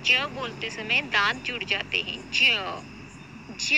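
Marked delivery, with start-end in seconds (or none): 2.3-3.7 ज with the teeth closed, buzzing; teeth-together z. Shown as a demonstration. Teacher shows how to say ज with teeth together. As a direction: close your teeth and buzz ज. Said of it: "ये बोलते समय हमारे दांत जुड़ जाते हैं".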